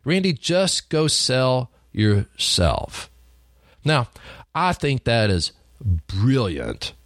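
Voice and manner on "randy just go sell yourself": Randy, just go sell yourself drawn out slowly; slow punchline. Retold or imitated. A salesman repeating his boss's advice, slow and weighty. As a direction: lean on every word of the advice. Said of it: "that's what he said to me".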